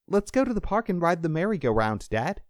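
put-on high, eager child voice; little boy. A narrator's reading. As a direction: pipe up like a small son wanting a treat. Boy begs dad for outing.

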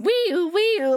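high wailing siren imitation; siren noise. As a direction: mimic a siren going off. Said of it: "it starts going".

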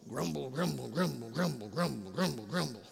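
low, soft mock grumbling; crowd grumbling.

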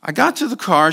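usual speaking voice

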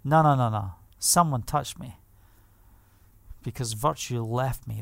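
low, soft and unhurried; quietly insist, dropping low in pitch; hushed insistence. Retold or imitated. Yeshua answering his disciples about the crowd, quiet.

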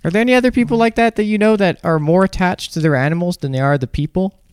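talking while chewing gum; words round a wad of gum. Real, not acted. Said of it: "oh, I should stop chewing gum".

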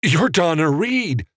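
high-pitched, half-shouted recognition. A narrator's reading. Evan recognising the movie star, half shouting. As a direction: blurt it high, short of a full shout. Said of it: "Evan half shouted".

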